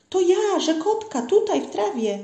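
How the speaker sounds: high, eager small-creature voice; little frog calling. A narrator's reading. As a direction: pipe up high to be noticed. Little frog calls to forester. (wysoki głosik wołającej żabki)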